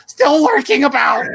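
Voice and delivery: high, slow, hammed-up cartoon line. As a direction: squeak it out like a cartoon.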